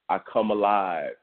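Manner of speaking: usual speaking voice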